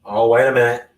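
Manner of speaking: ordinary talk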